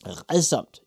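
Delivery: put-on gleeful old witch; laughing witch. A narrator's reading. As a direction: repeat it with relish. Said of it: "sagde den gamle kone og lo".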